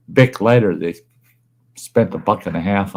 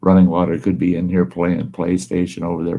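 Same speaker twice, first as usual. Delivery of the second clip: normal talking voice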